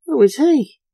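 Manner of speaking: high, loud astonished question; flabbergasted. A narrator's reading.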